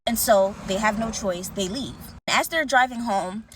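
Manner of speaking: ordinary talk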